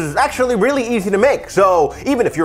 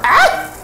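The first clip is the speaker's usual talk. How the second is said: startled yelp. Real, not acted. Startled yelp while cooking.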